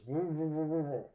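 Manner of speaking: mumbled through a full mouth, words lost; mumbling with a mouthful. Retold or imitated. Fisherman with worms in his mouth answers the other guy, mumbling. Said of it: "he mumbles".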